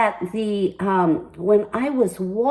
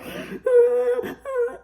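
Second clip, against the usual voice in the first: emotional laugh; 13 semitones higher than usual. Real, not acted. Laughing amid retelling the news.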